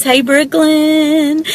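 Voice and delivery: ordinary delivery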